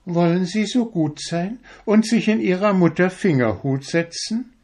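very high, tiny mouse voice; high mouse inviting Friedrich; polite little mouse. A narrator's reading. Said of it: "sagte die kleine Maus".

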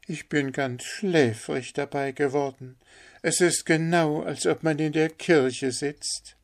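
high-pitched wife character voice; miller's wife. Acted. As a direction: speak up high as the admiring wife.

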